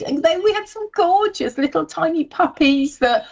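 high cooing over puppies; puppy voice. Real, not acted. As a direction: coo like talking to a puppy. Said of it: "Did you put your puppy voice on?".

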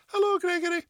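put-on high, tiny little-kid voice; wee child's voice. Presenter retelling the Gregory's Girl moment, in a high wee child's voice. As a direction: pipe it up like a small child on a bike. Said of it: "this wee voice goes".

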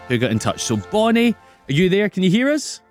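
normal talking voice

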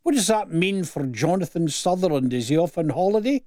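usual speaking voice

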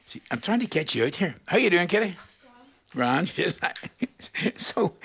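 usual speaking voice

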